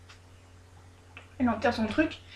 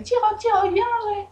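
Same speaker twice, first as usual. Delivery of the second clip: high sing-song call to a fish; 11 semitones higher than usual. Retold or imitated. Jong calling her little fish to come eat, high sing-song. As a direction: coax the pet fish in a high, lilting call. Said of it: "Jong a fait".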